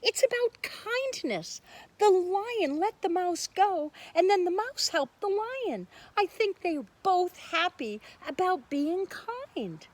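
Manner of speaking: put-on high, bright child's voice; child Minna. Minna explaining the moral to the class, high childlike. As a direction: speak like an earnest little girl.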